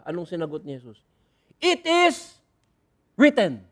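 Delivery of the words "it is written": firm declaration. Acted. Jesus answers Satan.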